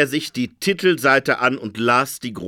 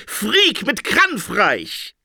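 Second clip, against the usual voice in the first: shouting; 8 semitones higher, much slower than usual. A narrator's reading. Interpreter proclaims war to Phoenix, shouting. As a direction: proclaim it with alarm.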